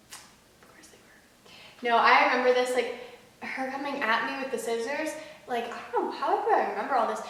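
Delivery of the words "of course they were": dry whispered aside; sarcastic whisper. Real, not acted.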